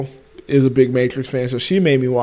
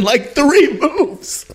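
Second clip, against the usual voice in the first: laughing; 10 semitones higher than usual. Real, not acted. Mocking the villain's quick defeat.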